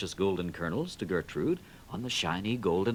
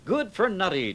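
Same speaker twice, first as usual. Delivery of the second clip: higher, louder cheer; 9 semitones higher, 5 dB louder than usual. A narrator's reading. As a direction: cheer for your friend. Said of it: "cheered all his friends".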